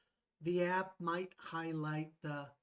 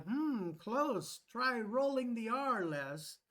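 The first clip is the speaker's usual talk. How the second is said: higher, lilting app reply; app feedback voice; 6 semitones higher, slower than usual. Acted. Pronunciation app correcting a learner's word, higher coaching voice. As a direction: play the app gently correcting, pitched up. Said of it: "the app could reply".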